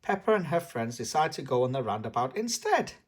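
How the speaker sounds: ordinary delivery, nothing marked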